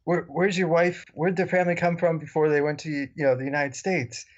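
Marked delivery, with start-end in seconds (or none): none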